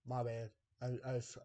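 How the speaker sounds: normal talking voice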